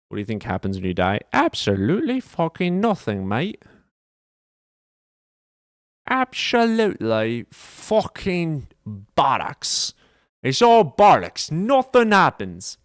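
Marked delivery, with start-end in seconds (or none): 1.3-12.8 higher, louder mock British accent. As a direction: rant it like a cheeky British bloke. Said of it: "I can't do the accent for very long".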